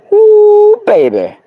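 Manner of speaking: very high, loud whoop; excited woo. Real, not acted. Feeling the crystal's energy, loud high whoop. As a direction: whoop with delight.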